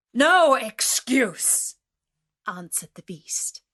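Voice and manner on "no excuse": much louder, slow, drawn-out denial; stern refusal. Acted. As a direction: slam the door on his excuse. Beast refuses the merchant.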